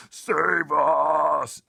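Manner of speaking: very loud, high terrified giant scream. Acted. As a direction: scream in nightmare terror. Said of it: "screamed the Flesh Lump Eater".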